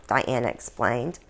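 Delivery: normal talking voice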